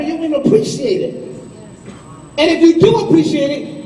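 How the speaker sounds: usual speaking voice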